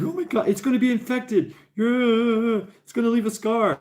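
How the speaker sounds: high, fussy imitation of his girlfriend; fretting girlfriend. Girlfriend worrying the nose gets infected, high fussy voice. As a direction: fret over the wound in her voice.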